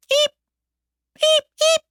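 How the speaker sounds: very high, short animal squeaks; tiny squeak. Acted. Hidden hamster squeaking in the closet, tiny and high. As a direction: squeak like a small hidden creature.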